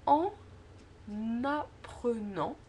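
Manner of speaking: slow "en apprenant", the n carried over as en, na-prenant; liaison. Shown as a demonstration. Teacher giving an example of liaison, syllables slowly separated. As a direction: split "en apprenant" so the n starts the second word. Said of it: "un exemple de liaison".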